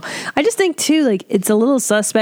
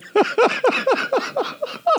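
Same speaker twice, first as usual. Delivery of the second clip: high laugh; 4 dB quieter than usual. Real, not acted. Cracks up at the story.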